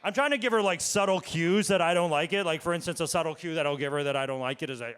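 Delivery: ordinary talk, nothing marked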